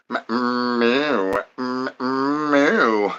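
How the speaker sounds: soft little cat mews; cat mewing. Pretend. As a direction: mew quietly like a kitten. Imitates a cat softly.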